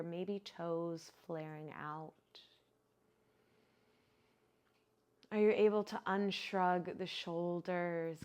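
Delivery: unhurried, even-toned guide into relaxation; guided meditation. Acted. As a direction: ease them into stillness, drawing out the phrase endings. Leads breathing and body relaxation.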